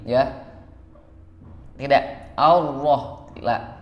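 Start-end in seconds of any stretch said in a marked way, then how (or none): none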